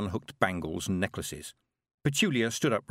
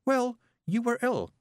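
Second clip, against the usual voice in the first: put-on high, slow, gentle young girl; girl excusing her friend; kind girl; 13 semitones higher than usual. A narrator's reading. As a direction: forgive her sweetly. Petulia forgiving.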